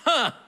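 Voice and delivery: short high triumphant ha; joyful exclamation; delighted laugh. Acted. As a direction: bark a happy laugh. Delighted at the news.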